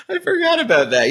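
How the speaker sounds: high laughing burst; giggly high realization. Real, not acted. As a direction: laugh out the words, caught off guard. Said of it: "laughing recollection".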